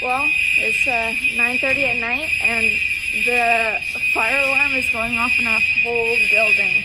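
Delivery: shouting over fire alarm. Real, not acted. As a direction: shout to be heard over the noise. Fire alarm blaring in building.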